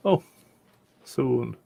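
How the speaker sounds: ordinary talk, nothing marked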